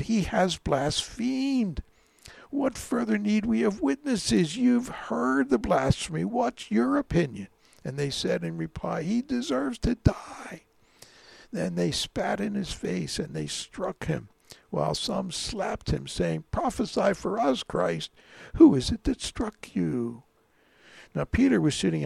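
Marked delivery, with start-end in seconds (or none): 0.0-7.5 high, loud outraged accusation. A narrator's reading. High priest accuses Jesus before the council, outraged. 9.0-10.6 high, slow condemning cry. A narrator's reading. Council answers the high priest, condemning. 16.5-20.2 raised, emphatic taunt. A narrator's reading. Mockers who slap Jesus demand prophecy, taunting.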